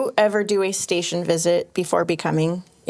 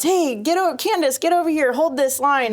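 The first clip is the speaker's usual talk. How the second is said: retold yell; 6 semitones higher than usual. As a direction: imitate the engineer calling her over, high and urgent. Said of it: "and she yells".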